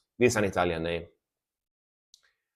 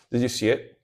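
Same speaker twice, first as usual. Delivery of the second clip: ordinary delivery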